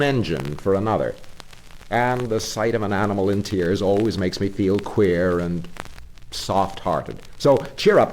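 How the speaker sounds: scold, then soften into reassurance; grave engine driver. Acted. Engine driver judging Toad gravely, then promising help. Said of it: "looked very grave and said".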